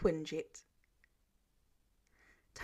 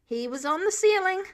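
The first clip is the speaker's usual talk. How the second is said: higher, louder insistence; insistent repeat; 9 semitones higher, 7 dB louder than usual. Acted. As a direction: hammer the point home. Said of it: "Clint repeated".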